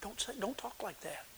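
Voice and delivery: urgent, very soft shushing; hushing someone. Acted. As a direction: hush them as if others might overhear. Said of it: "the first thing we generally do when we hear people talk like this".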